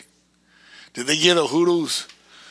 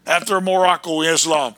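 ordinary talk, nothing marked

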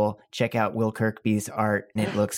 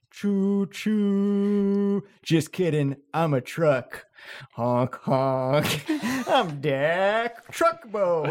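silly clown; 7 semitones higher, much slower than usual. Acted. Truck face speaks in scene.

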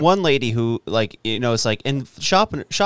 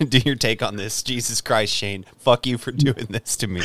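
laughing; 6 semitones lower, 6 dB quieter than usual. Real, not acted. Laughing off his own rant.

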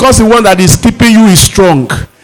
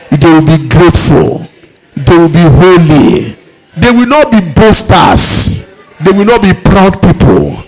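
prayer. Acted. Leads the church in prayer.

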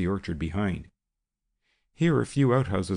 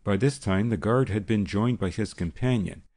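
usual speaking voice

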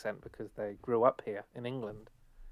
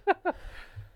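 breathy laugh. Real, not acted. Laughing at own narcissism joke.

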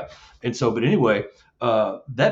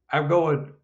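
ordinary talk, nothing marked

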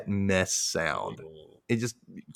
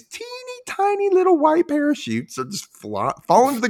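higher. Real, not acted. Describing tiny parachutes.

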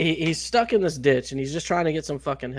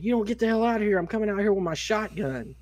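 higher-pitched mock threat; threatening; 7 semitones higher than usual. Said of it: "the dude started threatening him".

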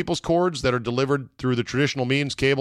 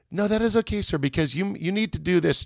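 mock-chipper customer service voice, much higher; hammed-up cheery call-centre voice, higher; chipper agent; 8 semitones higher than usual. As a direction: parody the relentlessly positive phone agent. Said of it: "in, like, this very positive, chipper way".

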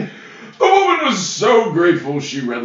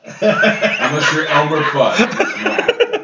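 slow laughing lisp of Elmer Fudd; mock Elmer Fudd; 3 semitones higher, 6 dB louder, much slower than usual. Joking that the W is silent, in Elmer Fudd's voice. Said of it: "The W is silent".

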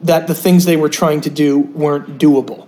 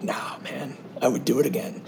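casual low mumble; 3 semitones lower, 13 dB quieter than usual. Retold or imitated. Retold casual reply.

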